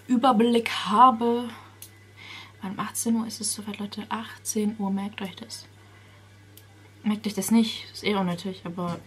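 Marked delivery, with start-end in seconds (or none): 2.6-5.7 whispered time. Real, not acted. Vlogger whispering the time to viewers. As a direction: breathe the time out.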